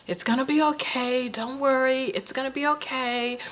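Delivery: put-on softly hushing a crying baby; soothing. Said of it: "trying to calm the baby".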